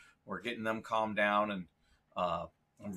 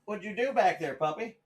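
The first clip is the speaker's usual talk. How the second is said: higher, louder playful question to dog; excited puppy talk; 8 semitones higher, 5 dB louder than usual. Real, not acted. Addressing the puppy off camera.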